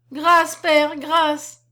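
high, loud begging for mercy; girl sobs for mercy at the door; sobbing plea for mercy. Acted. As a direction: cry out for mercy between sobs. Sobbing girl begs father.

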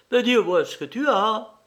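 high, loud, urgent questioning; exasperated demand. A narrator's reading. Mother breaks the silence to question son, exasperated. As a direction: press hard for an answer, pitch up.

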